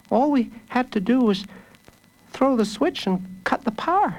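put-on high-pitched little boy's voice; high child's voice, explaining; child hero. A narrator's reading. Child hero explains his trick.

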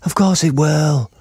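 put-on high, drawn-out child voices; children reassuring. Children insisting to the girl that it's safe, high and sure. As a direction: answer as confident kids, high and slow. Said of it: "the others said".

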